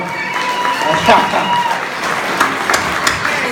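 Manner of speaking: breathless high wheezing laugh; helpless laughter. Real, not acted. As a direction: crack up at your own punchline. Laughing at own joke.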